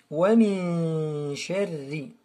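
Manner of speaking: "min" held long, n hidden; slow "Wa min sharri" with a sustained hum; ikhfa recitation. Shown as a demonstration. Teacher shows silent nun, hummed "min". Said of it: "On ne prononce pas le nun".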